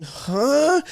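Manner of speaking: high startled huh. Retold or imitated. Hearing a strange request, startled high huh. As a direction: react baffled, voice up. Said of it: "I'm like".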